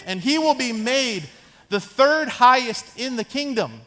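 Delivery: lightly acted king making a decree; proclaiming king. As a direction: announce it grandly, word by word. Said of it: "He brings them all in and says".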